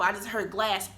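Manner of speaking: normal talking voice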